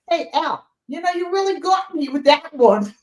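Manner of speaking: high-pitched Boston phone voice; Boston accent. Retold or imitated. Dog buyer admitting she was fooled, imitated Boston accent. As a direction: imitate a Boston woman conceding a joke. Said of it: "with her Boston accent".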